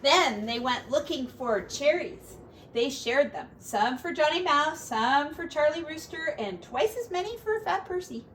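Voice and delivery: gentle storybook narration; reading to children. Acted. Parent reading a picture book to a child, storybook voice. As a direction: read it aloud to a little one.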